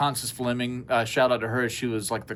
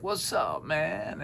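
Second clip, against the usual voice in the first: soft raspy weak greeting; 3 semitones higher, 6 dB quieter, much slower than usual. Retold or imitated. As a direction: rasp it out, barely able to talk. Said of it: "she kinda had a raspy voice".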